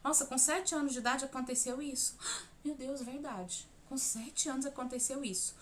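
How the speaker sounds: small gasp, then soft disbelief; softly talking to oneself in mock discovery; acted hushed realisation. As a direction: play a dawning memory, very quietly. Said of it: "E aí você vai se concentrar nisso".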